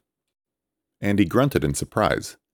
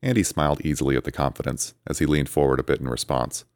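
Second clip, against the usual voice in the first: ordinary delivery, nothing marked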